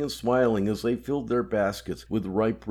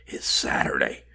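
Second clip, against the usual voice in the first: lower, hissed whisper; 7 semitones lower than usual. Acted. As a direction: hiss it low and secret. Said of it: "George's voice lowered and hissed".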